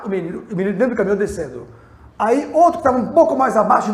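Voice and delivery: loud, driving pulpit storytelling; preaching story. Acted. Pastor tells the congregation about the runaway truck, in loud preaching. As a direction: build the drama of the runaway truck for the church.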